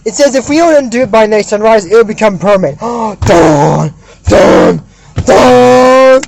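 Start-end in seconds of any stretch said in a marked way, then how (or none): 2.8-6.2 mock-ominous dun dun dun; dramatic suspense sting. Recapper marks the sunrise deadline with a mock-dramatic sting. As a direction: voice a cliffhanger music sting.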